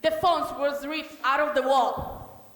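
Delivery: high, loud French maid voice; French accent. Acted.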